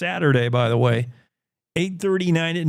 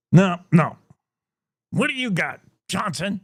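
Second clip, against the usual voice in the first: put-on a barely-there English accent from a gruff editor; weak English accent, editor barking at Johnson; failed English accent; 3 semitones higher than usual. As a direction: try an English accent and mostly miss. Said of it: "I can't do an English accent".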